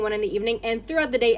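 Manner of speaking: usual speaking voice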